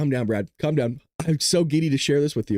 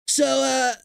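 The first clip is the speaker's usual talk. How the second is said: mock Trump; 9 semitones higher than usual. Imagined Trump calling Iran.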